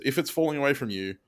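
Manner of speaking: ordinary talk, nothing marked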